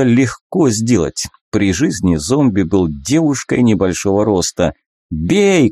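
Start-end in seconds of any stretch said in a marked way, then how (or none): 5.1-5.7 shouted command. Acted. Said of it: "крикнул я".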